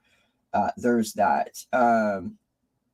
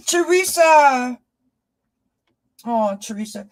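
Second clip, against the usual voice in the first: joyful call; 12 semitones higher, 5 dB louder than usual. Real, not acted. Spotting a loyal friend in chat, calling out with joy. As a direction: greet her like a long-lost friend.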